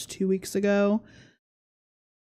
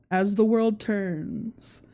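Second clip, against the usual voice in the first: slow, hushed dramatic announcer; soap opera sign-off; much slower than usual. Pretend. Vlogger sums up the drama like a slow soap narrator. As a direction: intone it like the end of a daytime soap.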